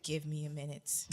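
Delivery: low, quiet, holding it in; restrained request. Retold or imitated. Upset wife asks husband for time, restrained. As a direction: drop right down to keep control. Retelling asking for time to cool down.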